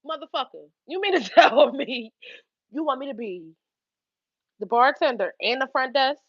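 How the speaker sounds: ordinary talk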